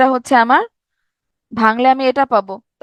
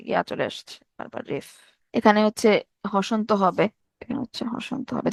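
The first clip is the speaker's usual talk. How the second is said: muttered aside; 5 semitones lower, 8 dB quieter than usual. Real, not acted. Teacher grumbles to himself about typo.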